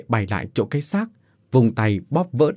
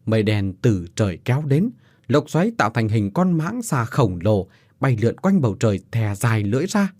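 ordinary delivery, nothing marked

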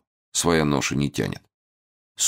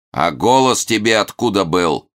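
mock evil villain; 6 semitones higher, 5 dB louder than usual. Hermit interrogates.

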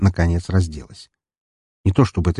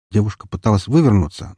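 usual speaking voice

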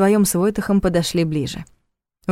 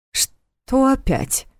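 worried; 7 semitones higher, much slower than usual. A narrator's reading. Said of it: "обеспокоенно уточнил Нев".

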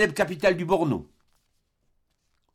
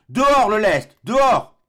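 loud, higher barked order; urgent shout; 7 semitones higher, 8 dB louder, slower than usual. Acted. Doctor ordering his men to dump ballast, urgent loud shout. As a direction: yell the command to dump the ballast.

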